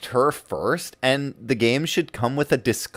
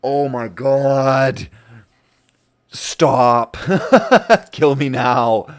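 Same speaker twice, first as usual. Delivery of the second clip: mock exasperation; 7 dB louder, much slower than usual. Exasperated gamer reaction, retold.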